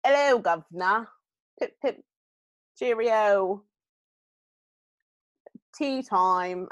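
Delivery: mock British accent. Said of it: "it's not really, like".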